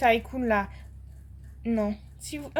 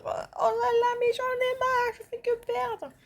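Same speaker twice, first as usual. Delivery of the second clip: exasperated whine; 10 semitones higher, 3 dB quieter than usual. Real, not acted. Exasperated at losing again.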